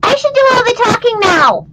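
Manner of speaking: high, playful puppet voice; chirpy little puppet character; What What puppet voice. Acted. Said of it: "This is my little friend".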